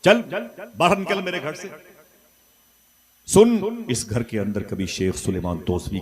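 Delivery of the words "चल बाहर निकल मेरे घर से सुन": high, sharp, shouted scolding; angry scolding wife. Acted. Wife shouts at husband to get out of her house, angrily. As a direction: throw him out of the house with a yell. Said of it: "उसने डांट के कहा".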